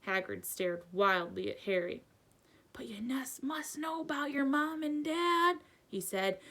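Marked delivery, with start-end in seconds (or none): 2.7-5.6 higher, pleading disbelief; mildly astonished, pitched up; disbelieving. A narrator's reading. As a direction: insist in hushed amazement.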